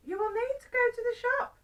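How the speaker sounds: high-pitched wife voice; wife giving instructions. Acted. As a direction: give orders in a high singsong. Wife instructs husband in story.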